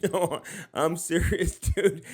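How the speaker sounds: laughing through the words; can't keep a straight face while insisting. Real, not acted. Host laughing while swearing he's serious about Caitlin Clark.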